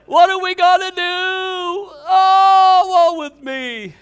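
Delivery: high, loud hammed-up wail of despair; mock wailing panic. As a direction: wail like a doomed believer. Preacher mocks fearful wailing Christians.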